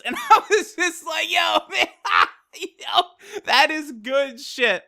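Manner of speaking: high, excited laughter breaking up the words; laughing. Real, not acted. Fan recalls his reaction to Goku's line, laughing hard. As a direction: crack up while reacting.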